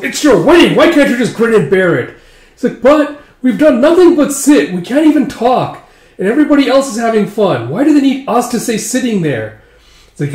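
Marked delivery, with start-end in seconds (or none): 0.0-2.2 loud, high exasperated scold; mother scolding. Acted. 2.8-9.6 put-on high, loud childish whine; whiny bored brides. Brides protesting to mother.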